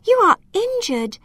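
high, concerned exclamation; alarmed cry. A narrator's reading. Girl to the injured elf, high alarmed cry. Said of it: "cried Alma".

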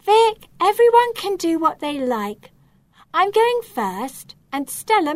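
high, loud and firm; answering back. A narrator's reading. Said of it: "Romy answers back".

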